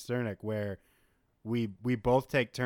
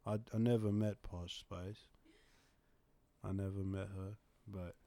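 soft, dreamy, quieter British voice; 2 semitones lower, 4 dB quieter than usual. Pretend. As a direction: muse softly like a pop star in interview. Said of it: "this is the real one".

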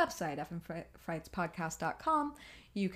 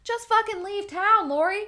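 exasperated yell; 12 semitones higher, 10 dB louder than usual. Pretend. Yelling advice at character.

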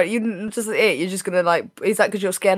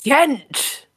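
high bright Kent!; playful exclaim; 6 semitones higher than usual. Pretend. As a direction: bounce it back excitedly. Host echoes Kent playfully.

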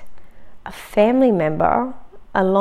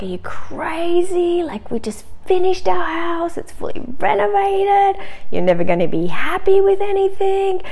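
hammed-up furious husband, pitched way up; angry husband; 11 semitones higher than usual. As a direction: squeak out his outrage in a much higher put-on voice. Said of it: "he was so angry".

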